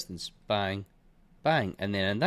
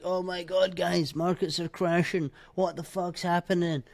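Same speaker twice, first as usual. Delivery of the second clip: higher, put-on whiny panic voice; mock panicking chartist; 7 semitones higher than usual. Trader mocking five-minute-chart crash callers, higher put-on panic. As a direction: parody a clueless trader shouting crash. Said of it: "And they'll be like".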